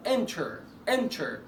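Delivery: "enter" with a light English T; standard English. Shown as a demonstration. Teacher offering the accepted alternative, T sounded. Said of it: "لكن ما اسمهاش انتر".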